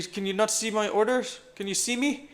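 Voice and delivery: ordinary talk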